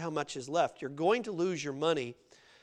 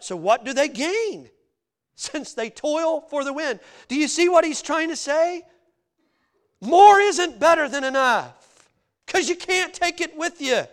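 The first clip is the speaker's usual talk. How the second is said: high, raised preacher's voice; impassioned preaching; 12 semitones higher, 9 dB louder than usual. Real, not acted. Preacher presses congregation that more isn't better, impassioned. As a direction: press the question, then punch the answer.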